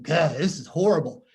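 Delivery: put-on eye-rolling kid. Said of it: "rolling their eyes and going".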